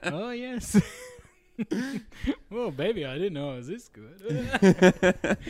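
a hammed-up bedroom purr, slow and giggly; mock lover in bed. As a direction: ham up a lover mid-romp, slowly, through laughter. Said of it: "Tim's pretending he's getting a root".

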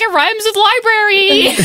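high, drawn-out mimicry of her pronunciation; mocking impression of co-host. Co-host teasing how she says documentary, high mocking imitation. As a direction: stretch it out high to tease her. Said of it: "She's like".

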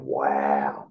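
low-pitched, amazed imitation; stunned onlooker. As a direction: drop the voice deep in mock astonishment. Imitates worker stunned by his eating.

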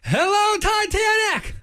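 high-pitched rock-concert yell; shouted into microphone. Acted. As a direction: holler to the crowd as the band's drummer. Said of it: "I accidentally shouted into the microphone".

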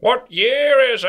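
dramatic announcer. Pretend. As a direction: boom out the segment title. Announcing the segment title.

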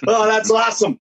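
loud, high delighted shout; excited shout. Real, not acted. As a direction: burst out in delight. Reacts to funny saying.

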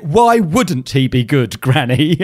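slow, loud mock protest; drawn-out, hammed-up challenge; mock exasperation. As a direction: stretch it out in comic disbelief at granny. Mock challenge to his gran's remark.

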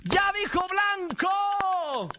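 high, long-drawn triumphant cry; game-show gotcha shout. Real, not acted. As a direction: shout out the forbidden word, stretching it. Presentador canta la palabra prohibida. (grito agudo y alargado de concurso)

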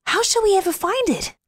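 put-on high, anxious child voice; little boy voice. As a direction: ask helplessly like a small boy. Boy worried in story.

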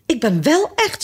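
high, mildly upset insistence; tearful protest. A narrator's reading. Alice insists she is real, mildly tearful protest. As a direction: protest with a touch of coming tears. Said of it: "zei Alice en begon te huilen".

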